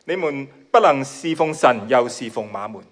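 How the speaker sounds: ordinary talk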